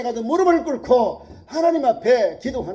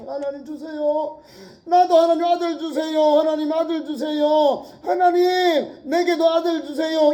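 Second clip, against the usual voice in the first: higher, louder, slow desperate plea; pleading prayer; 6 semitones higher, 4 dB louder than usual. Retold or imitated. Hannah begging God for a son, slow pleading cry. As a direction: beg God for a son, voice straining. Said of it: "하나님 앞에 문을 두드려".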